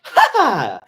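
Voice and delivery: loud, high ha ha; mocking laugh. Brother laughing at his sister, loud mocking ha ha. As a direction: bark out a gleeful ha ha. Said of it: "Jeff snorted and laughed".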